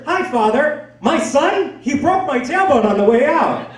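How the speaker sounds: high, loud mother greeting the priest; oversharing mother. Retold or imitated. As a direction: announce it cheerfully high-pitched to the priest.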